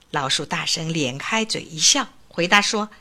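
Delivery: ordinary delivery, nothing marked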